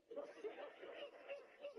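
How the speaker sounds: airy high laugh through the burn; wheezing laugh at his own pain; laughing helplessly at the heat; breathless pained laugh. Real, not acted. Spicy chip pain.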